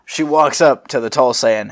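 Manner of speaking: usual speaking voice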